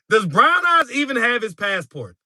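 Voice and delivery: high-pitched question breaking out of a laugh; laughing disbelief. Real, not acted. Streamer reacting to a viewer's trip suggestion, laughing disbelief.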